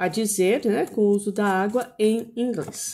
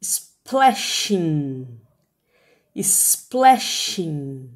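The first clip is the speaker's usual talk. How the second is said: SPLASHING stretched out, lower in pitch; long, careful SPLASHING for repeating; slow teaching demo; 5 semitones lower, much slower than usual. Shown as a demonstration. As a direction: model SPLASHING slowly, each syllable held. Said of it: "Eu vou falar devagar agora".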